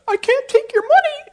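very high tearful falsetto; high weepy refusal; crying woman voice. Retold or imitated. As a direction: voice her choked up.

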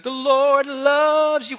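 high, drawn-out defiant cry; mock-heroic martyr calling out; martyr's shout. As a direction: shout it slowly to the firing squad. Martyr calling out before firing squad.

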